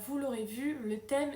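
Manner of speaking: usual speaking voice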